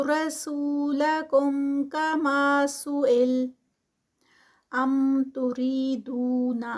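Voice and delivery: slow drawn-out tajweed chant; Quran recitation. Acted. Teacher reciting the verse to students, slow chant. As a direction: recite the verse slowly, stretching the vowels.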